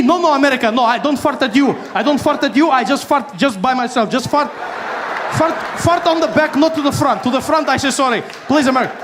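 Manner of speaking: high, apologetic excuse-making; mock anxious pleading. Iran apologises to America for farting, mock anxious pleading. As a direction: beg for mercy, played for comedy.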